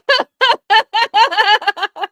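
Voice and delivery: big high laugh bursting out; sudden hearty laugh; loud burst of laughter. Real, not acted. Laughing at chat remark.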